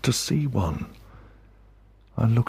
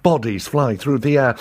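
ordinary delivery

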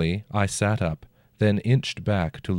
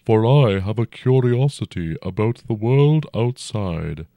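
dragon voice; 3 semitones higher, 4 dB louder than usual. A narrator's reading. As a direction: speak as a huge old dragon, unhurried. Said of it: "the dragon said".

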